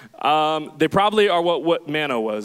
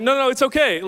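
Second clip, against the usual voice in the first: higher, brighter dismissive no; carefree brush-off; 7 semitones higher, 4 dB louder than usual. Retold or imitated. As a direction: wave off the warning. Brushing off the driver's warning.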